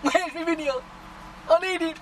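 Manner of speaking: high, whiny imitation of impatient fans; mocking whiny viewers. Vlogger imitating viewers who want a new video, high and whiny. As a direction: mimic the demanding viewers in a high whine. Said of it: "you lot sitting there going".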